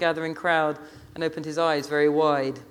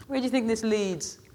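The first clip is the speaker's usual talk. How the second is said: reproachful Rasta woman; 6 semitones higher, 3 dB quieter than usual. A narrator's reading.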